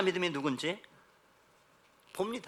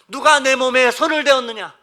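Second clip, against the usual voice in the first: loud, raised, commanding question; Jesus; 8 semitones higher, 13 dB louder than usual. Acted. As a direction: demand an answer from the whole crowd. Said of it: "갑자기 돌아서서 물으세요". (높고 크게 위엄 있게 추궁하듯)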